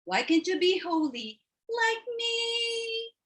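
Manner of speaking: April preaching at her sister; pious sister. Acted. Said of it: "that's my sister, April".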